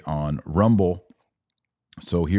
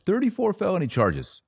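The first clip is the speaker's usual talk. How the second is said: thirty-four punched much higher; emphatic repeat; 12 semitones higher than usual. Acted. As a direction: hammer home the number in disbelief. Repeating the charge count emphatically.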